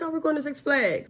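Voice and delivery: high, lightly voiced social worker; imitating social worker. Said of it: "she's like".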